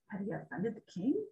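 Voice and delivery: ordinary delivery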